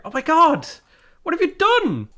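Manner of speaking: aghast at what the rival did; disbelief. Real, not acted. Gamer reacts to rival crushing her monopoly, aghast.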